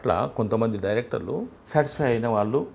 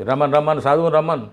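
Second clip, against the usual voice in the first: calling out; 5 semitones higher, 6 dB louder than usual. Retold or imitated. Crew calls the actor to set.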